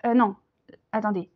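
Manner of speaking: usual speaking voice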